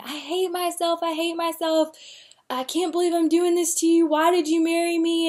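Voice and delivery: a lightly acted echo of crying out in despair; retold despairing cry. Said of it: "I was screaming".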